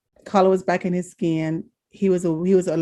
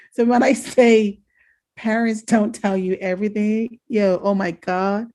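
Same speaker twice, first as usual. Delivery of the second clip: usual speaking voice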